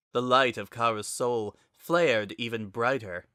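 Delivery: plain reading voice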